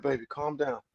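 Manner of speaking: soft, low, tender reassurance; calm sensual. Retold or imitated. Man soothing his partner, calm and sensual. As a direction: soothe her, calm and masculine. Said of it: "with this calm, sensual, but you still masculine".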